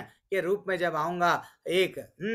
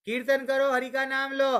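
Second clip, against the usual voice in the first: higher, bright rallying call; splashing water on elephants, cheerful higher call; 8 semitones higher than usual. Retold or imitated. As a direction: urge the elephants to sing. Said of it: "डाल के बोलने लगे".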